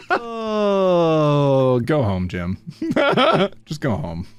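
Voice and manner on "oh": ordinary talk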